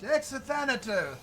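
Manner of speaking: high, loud in-character yell. Acted. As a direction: holler to a distant ally. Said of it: "I yell out".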